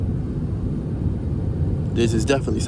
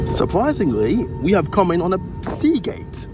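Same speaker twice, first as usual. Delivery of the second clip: pleased, higher voice; delighted at the gate; surprised; 8 semitones higher than usual. Real, not acted. Arriving at a hoped-for gate.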